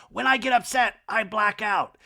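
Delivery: high, mock-dramatic insistence; exaggerated high-pitched impression; Kyle. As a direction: declare it high and intense, hammed-up. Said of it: "Kyle in this scene goes".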